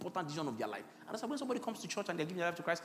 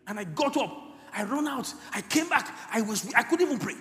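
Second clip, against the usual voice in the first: loud, raised cry of grief; anguished retelling; 4 semitones higher, 7 dB louder than usual. Retold or imitated. Pastor kneels by her body, anguished and loud. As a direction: preach the anguish at full voice. Relives weeping over the body.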